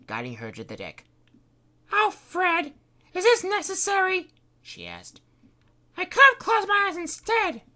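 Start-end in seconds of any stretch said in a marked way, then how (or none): 1.9-4.3 put-on much higher, a young woman's grumbling voice; put-upon girl humouring Fred; Kitty's voice. Kitty voiced high throughout. 6.0-7.6 put-on much higher, a young woman's grumbling voice; put-upon girl humouring Fred; Kitty's voice. Kitty voice held.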